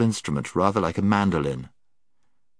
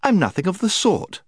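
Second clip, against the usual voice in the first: very high, cross retort; indignant; 13 semitones higher, 3 dB louder than usual. A narrator's reading. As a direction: protest as if stamping your foot. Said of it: "stamping her foot".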